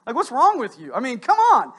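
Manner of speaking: rising into a loud, exasperated scold; mock scolding critic. Critic scolding a tired mom.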